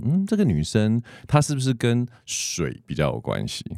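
ordinary talk

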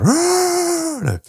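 sudden high ghostly roar; huge fiery dragon roar. Pretend. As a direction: roar like a monster to scare. Ghost scaring sleeper.

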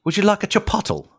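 high-pitched mock British invitation; British accent. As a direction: put on a high British voice, mispronouncing chipotle. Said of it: "I want to hear a British person".

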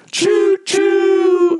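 high loud choo choo; train whistle imitation. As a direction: hoot like a toy train. Mock train whistle joke.

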